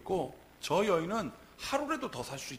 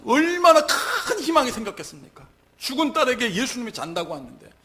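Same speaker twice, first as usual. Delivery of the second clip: high, loud exclamation of hope; impassioned preaching; 10 semitones higher, 15 dB louder than usual. Real, not acted. Preacher on the father's newfound hope, impassioned. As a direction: proclaim the hope with full voice.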